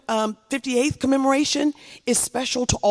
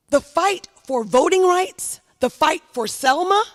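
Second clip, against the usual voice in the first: oratorical build; 6 semitones higher, 3 dB louder than usual. Real, not acted. Rising oratory climax.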